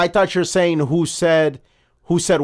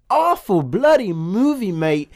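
imitating Aldous Snow's English accent; British accent; 7 semitones higher than usual. To friends, quoting the movie, mock British accent. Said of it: "He's like".

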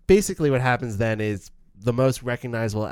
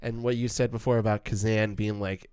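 normal talking voice

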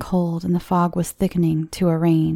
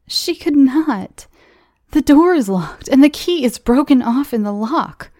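much higher, louder urgent correction; frightened friend breaks the news; urgent alarm; 7 semitones higher, 5 dB louder than usual. Acted. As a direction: insist the door is locked, alarmed.

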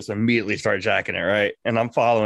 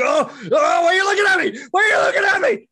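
much higher screaming put-on voice, louder; screaming ghost; 4 dB louder than usual. Ghost screaming at the man who sees him, high shriek. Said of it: "It's like".

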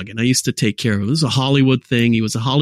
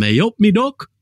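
put-on light Nottingham accent, higher and chirpy; Nottingham greeting; 6 semitones higher than usual. Guest greets host as a Nottingham local, light Nottingham accent. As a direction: toss off a cheery East Midlands "Eh, up me duck". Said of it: "now that I'm in Nottingham".

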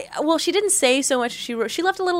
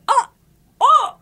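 surprised; 12 semitones higher, 5 dB louder than usual. Retold or imitated. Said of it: "I'm like".

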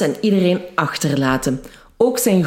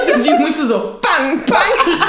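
high, laughing "pang, pang"; play-acting shots with your mouth; imitated gunshots; 10 semitones higher, 3 dB louder than usual. Soldiers without bullets imitate gunfire.

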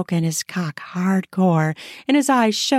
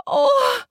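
high gasped "oh"; sharp intake, then a high cry; startled gasp. Acted. Wife spots the neighbor at the window, gasping. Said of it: "I gasped".

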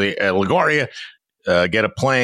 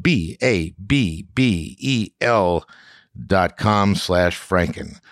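spelling it out slowly; much slower than usual. Shown as a demonstration.

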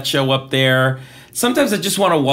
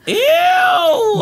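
high shrieking "ew"; disgusted shriek; 3 dB louder than usual. Real, not acted. Reacting to explicit pic.

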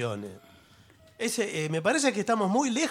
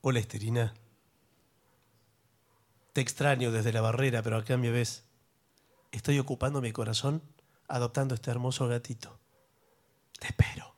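woo her like a smooth leading man; 2 semitones lower than usual. Acted. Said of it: "esa voz de galán".

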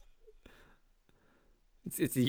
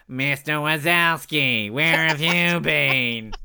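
slow, drawn-out droning character voice; exaggerated slow receptionist impression; Monsters, Inc. desk woman; slower than usual. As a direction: drag out every word like a dour desk clerk. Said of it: "She sounds like the, um, in Monsters".

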